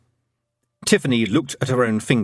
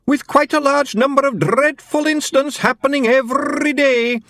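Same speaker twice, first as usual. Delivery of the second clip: recited verse; 4 dB louder than usual. A narrator's reading.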